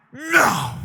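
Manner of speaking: put-on sulky little-kid no; pouting no. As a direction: pout the word out like a toddler. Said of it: "side eye to Jesus".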